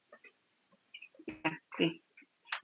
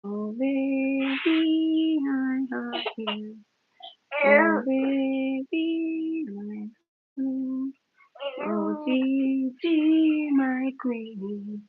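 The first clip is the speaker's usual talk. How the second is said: very slow, higher, drawn-out cooing; 4 semitones higher, 3 dB louder, much slower than usual. Real, not acted. Cooing baby to sleep.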